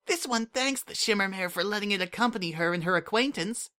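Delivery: put-on high, bright-eyed childlike voice; a small changeling child asking a favour, eager; Kukri, eager changeling kid. A narrator's reading. Kukri asks the Shimmermare for room service, eager and high.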